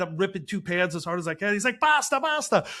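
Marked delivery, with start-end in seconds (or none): 1.8-2.7 high, loud yelled repeats; yelling. Retold or imitated. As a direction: shout it at the cook to stop. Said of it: "like, yelling".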